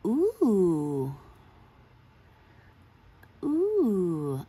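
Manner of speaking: long, swooping oohs; excited ooh. Real, not acted. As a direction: ooh that rises, then slides down slowly. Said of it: "I'm excited".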